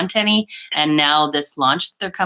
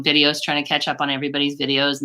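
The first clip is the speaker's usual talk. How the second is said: normal talking voice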